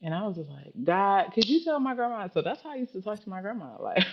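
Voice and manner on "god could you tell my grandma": pleading prayer. Retold or imitated. Acts out her childhood prayer.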